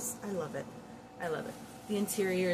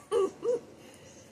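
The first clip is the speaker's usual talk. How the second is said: high giggle. Real, not acted. Laughs about taking scarf back.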